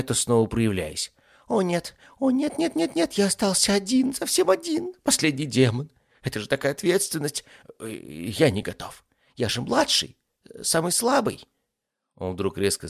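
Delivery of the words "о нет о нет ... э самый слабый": high-pitched, cowardly ifrit in a panic; panicking demon. Acted. Ifrit realises he is the last demon, panicked. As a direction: wail it high, in rising panic.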